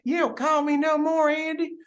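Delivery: high, whiny pretend grievance; hammed-up needy complaint; mock complaining. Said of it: "I can't be like".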